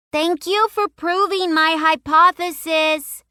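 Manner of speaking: high, slow shout after him. A narrator's reading. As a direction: call it out loud, girl's voice. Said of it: "Rose shouted after him".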